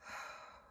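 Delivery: soft, breath-only exhale; soft sigh. Real, not acted. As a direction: let out a quiet settling breath. Settling breath during meditation.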